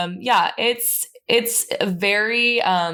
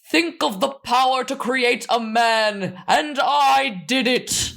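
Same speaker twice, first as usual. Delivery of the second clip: slow, higher grandiose mad scientist; mock grandiose; 5 semitones higher, slower than usual. Quoting Frankenstein's boast, slow mock grandiose. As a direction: savor every word like a proud creator. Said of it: "another quote that I put is".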